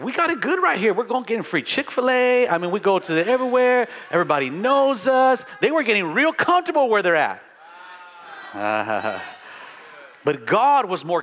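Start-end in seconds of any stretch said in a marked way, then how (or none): 0.0-5.5 smug disciples. Retold or imitated. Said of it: "And disciples were like".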